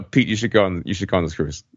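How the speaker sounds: normal talking voice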